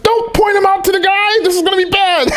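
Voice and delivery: high mock-panicked whisper-plea; alarmed plea. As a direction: beg him not to point Job out. Pleading with God in story.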